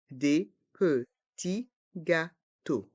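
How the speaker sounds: slow "Des petits gâteaux", every syllable apart; learner-paced, split "Des petits gâteaux". Shown as a demonstration. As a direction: separate each sound of "Des petits gâteaux". Teacher breaks phrase into syllables.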